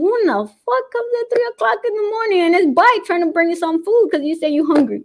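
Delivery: high-pitched, rushed mock disbelief; mock incredulous. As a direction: retell it as a fast, high outburst of disbelief. Retold outraged reaction to night visit.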